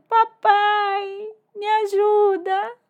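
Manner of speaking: high, loud acted wail; hammed-up tearful cry, stretched out; crying boy. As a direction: wail for help like a lost child. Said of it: "ele lá do outro lado chorando".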